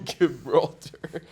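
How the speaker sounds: Gibraltar said loud through a laugh. Real, not acted. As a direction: laugh while saying Gibraltar. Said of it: "Oh, buddy".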